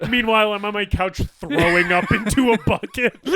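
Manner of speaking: loud, barely holding back laughter; laughing. Real, not acted. Joking about own disgust at the impression, laughing loudly. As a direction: talk loudly through laughs. Cracking up at the bit.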